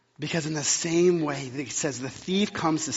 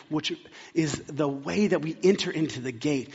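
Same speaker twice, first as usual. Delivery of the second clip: ordinary delivery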